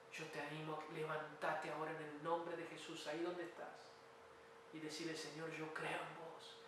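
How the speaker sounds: hushed, earnest invitation to pray; altar call. Real, not acted. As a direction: gently lead them into prayer. Preacher leading a prayer of faith.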